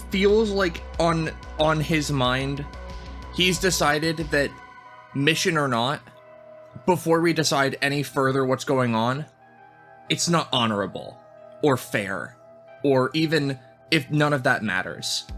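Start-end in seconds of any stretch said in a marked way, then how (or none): none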